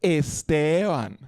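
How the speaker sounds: Esteban slow and lilting, Colombian style; Colombian pronunciation. Retold or imitated. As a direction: draw out Esteban like Colombian parents. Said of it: "My parents go".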